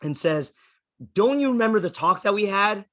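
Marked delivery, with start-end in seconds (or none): 1.1-2.8 higher, louder indignant question; mock angry mom. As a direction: scold like an exasperated mother, lightly acted. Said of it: "gets all mad".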